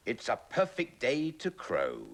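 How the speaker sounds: rooster voice. Acted. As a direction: crow the words out proudly. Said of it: "thought the cock".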